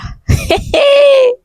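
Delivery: high, wailing half-sob laugh; crying laugh. Real, not acted. Seeing zero naira, a wailing laugh. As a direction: laugh that tips into a wail.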